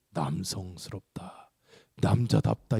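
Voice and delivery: breathy hushed admiration, slow; whispered mock awe; awed whisper. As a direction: hush it like something impressive. Whispered admiring quote of Westerners.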